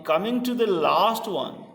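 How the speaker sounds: ordinary talk, nothing marked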